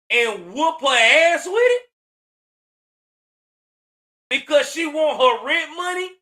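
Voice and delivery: rising, loud incredulous yell; real outrage; outraged shout. Real, not acted. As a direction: yell it in disbelief. Outraged at daughter attacking mother.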